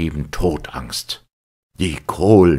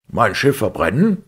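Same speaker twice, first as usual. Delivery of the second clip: louder, higher outraged yell; outraged shout; 7 semitones higher, 5 dB louder than usual. A narrator's reading. Captain screaming at Fogg about burning his ship, outraged. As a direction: scream it in disbelief. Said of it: "schrie der Kapitän Speedy".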